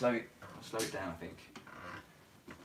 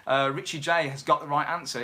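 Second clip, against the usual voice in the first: ordinary talk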